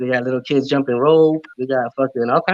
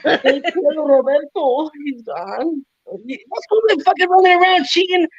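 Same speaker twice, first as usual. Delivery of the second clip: high mock grandma shouting at her telenovela; grandma yelling at the TV; 11 semitones higher than usual. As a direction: squawk at the screen like an upset grandma. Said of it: "your grandma".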